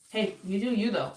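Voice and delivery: ordinary delivery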